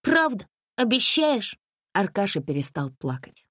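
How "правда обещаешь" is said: put-on hopeful child. Boy hopeful.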